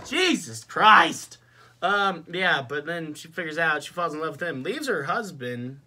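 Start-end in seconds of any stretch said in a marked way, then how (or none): 0.1-1.4 slow, high, loud outburst; louder exasperation. Real, not acted. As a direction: drag it out loudly, fed up. Repeats exclamation, more heated.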